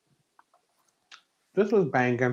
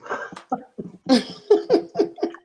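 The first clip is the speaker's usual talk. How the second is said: high-pitched giggle; high laugh; 10 semitones higher, 4 dB quieter than usual. Real, not acted. Laughing at the Baby Ray's joke.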